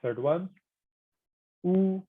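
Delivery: ordinary delivery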